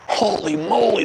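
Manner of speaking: a touch of hammed-up astonishment; mock amazement. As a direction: relive the shock of the smell. Recalling skunk weed smell.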